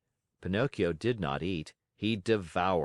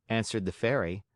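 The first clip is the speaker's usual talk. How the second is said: ordinary delivery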